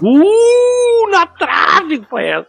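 high wail of a near miss; so close it hurts; anguished near miss. Real, not acted. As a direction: groan as the answer just misses. Near miss in a quiz game.

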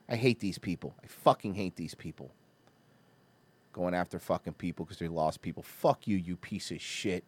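low, soft bitter venting; seething disgust. Real, not acted. Host venting at people who mock the grieving, quietly seething.

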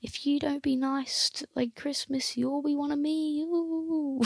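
high, soft spooky wail; haunt them like a stagey ghost; put-on spectral voice, trailing into ooh. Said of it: "was like a ghost".

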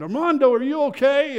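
high, loud anxious call; father calling down to his trapped son, loud and high. Retold or imitated. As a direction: shout down to him, relieved and urgent. Father calls son under rubble.

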